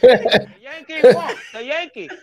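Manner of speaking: high, loud mock accent with a laugh, slow at first; laughing impression of a Spanish Yankees fan; imitating Spanish fan. Podcaster mimics Spanish Yankees fan celebrating, loud high mock accent. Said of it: "Spanish fan voiced".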